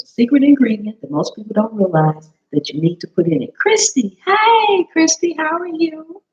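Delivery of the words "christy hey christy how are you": high, rising hello to a friend; delighted greeting. Real, not acted. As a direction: greet her like a surprise guest. Greeting arriving viewer.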